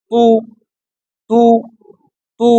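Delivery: তু with lips rounded. Shown as a demonstration. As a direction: say তু with round lips, short and clean. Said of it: "ঠোঁটটা গোল". (ঠোঁট গোল করে তু)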